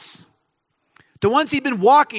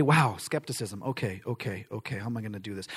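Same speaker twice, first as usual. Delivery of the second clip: very low, quiet musing; mock worried muttering to oneself; thinking aloud; 11 semitones lower, 9 dB quieter than usual. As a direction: puzzle it out under the breath. Imagined Jesus puzzling to himself.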